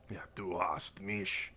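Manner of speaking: slow, low mock German accent; half-whispered fake German accent. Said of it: "should I just fake a German accent?".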